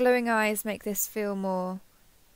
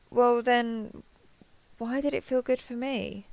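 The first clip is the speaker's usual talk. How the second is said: usual speaking voice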